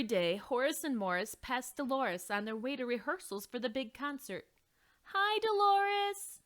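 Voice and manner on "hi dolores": high, loud, slow friendly call; high slow friendly greeting; sing-song greeting. Acted. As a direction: call out cheerily.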